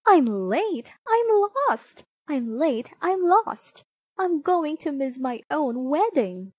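a late bride's cry, kept in storyteller bounds; mild story yell. A narrator's reading. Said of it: "She was yelling".

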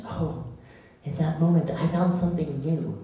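normal talking voice

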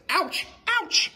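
very high pained squeal; cartoon ouch cries; squeaky ouch. A narrator's reading. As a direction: yelp like feet are burning. Feet burning on sand.

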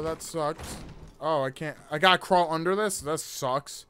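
ordinary talk

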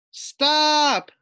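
high, loud panicked cry; frightened shout. A narrator's reading. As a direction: yell it as you wobble. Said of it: "thought Fluffy".

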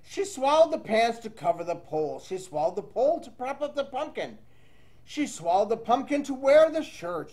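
lively read-aloud storytelling voice; reading to children. Acted. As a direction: read the rhyme to young kids. Teacher reads picture book aloud.

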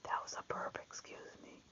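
hushed whisper so the dog sleeps on; breathless whisper so as not to wake him. Real, not acted.

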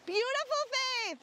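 soaring high, delighted coo; high excited cooing at the model; gushing praise. Real, not acted. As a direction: gush over her like she's stunning. Photographer gushing over model.

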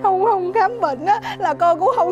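high, loud, urgent pleading. Acted. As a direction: beg grandma loudly and fast. Grandson pleads loudly to grandma.

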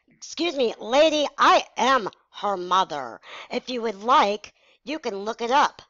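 imitating an affronted mother, punchy stresses; livid mom. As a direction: put a stranger in their place as mom. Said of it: "she was shaking livid".

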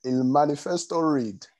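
Spanish in a Nigerian accent; slow Spanish with a Nigerian accent. Shown as a demonstration. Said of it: "speaking in a Nigerian accent".

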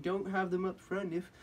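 high-pitched mock complaining customer; hammed-up triggered whine. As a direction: imitate an indignant customer in a high voice. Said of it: "he was getting all triggered".

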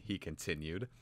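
usual speaking voice

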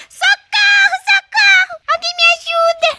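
high, loud child's mock call for help; boy stuck in tree yelling for help; pretend cry for help. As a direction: call out from the tree, hammed-up panic.

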